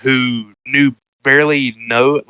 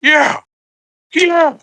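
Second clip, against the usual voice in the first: high squeaky mock praise, yeah yeah good job; mock them with a much higher, sing-song voice; mocking crowd; much slower than usual. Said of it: "they're like".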